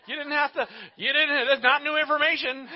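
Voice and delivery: high, loud comic protest with a laugh; mock exclaiming. Teasing someone who announced being upset, loud mock protest. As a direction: protest the obvious loudly, half laughing.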